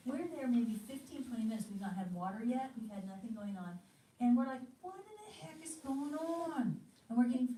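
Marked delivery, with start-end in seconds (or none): 4.8-6.8 exasperated. Retold or imitated. No waiter for twenty minutes.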